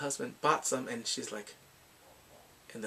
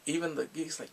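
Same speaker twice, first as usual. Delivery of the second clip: usual speaking voice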